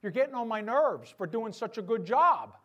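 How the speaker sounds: hammed-up indignation, swelling at the end; mock complaint. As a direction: grumble absurdly at the one who saved you. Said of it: "me going to my heart surgeon and going".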